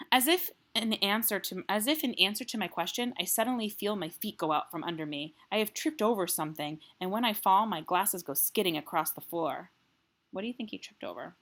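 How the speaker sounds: reading a chapter aloud to the class; story narration. Acted. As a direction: tell the story in the boy's voice. Teacher reads the novel aloud.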